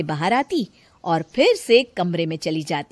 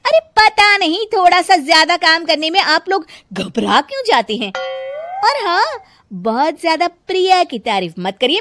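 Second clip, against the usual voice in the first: much higher, loud and whiny; defiant retort; 9 semitones higher, 7 dB louder than usual. Acted. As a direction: throw a tantrum back at her. Daughter-in-law retorts defiantly.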